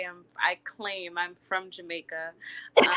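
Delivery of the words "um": loud laugh on the filler. Real, not acted. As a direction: burst out laughing mid-answer. Guest bursts out laughing.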